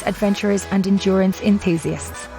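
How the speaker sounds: usual speaking voice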